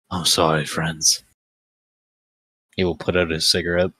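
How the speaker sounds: sly gambler character voice; lightly voiced cool gambler.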